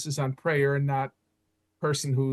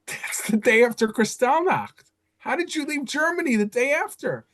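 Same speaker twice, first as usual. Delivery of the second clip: high, amazed question about the escape date; can't believe what he just heard; amazed disbelief; 9 semitones higher, 4 dB louder than usual. Retold or imitated. Narrator asking the old man, in high astonished disbelief.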